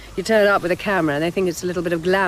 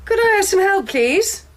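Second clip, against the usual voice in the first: calling out; 10 semitones higher than usual. Acted. Calling out in empty shop.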